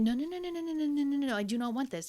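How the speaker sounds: high, rushed string of no's; mock-panicked, begging him to stop; a flustered girl pleading high; panicked refusal. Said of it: "And she's like".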